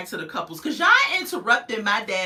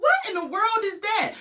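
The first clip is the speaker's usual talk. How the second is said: startled; 14 semitones higher than usual. Retold or imitated. Her own startled reaction, quoted.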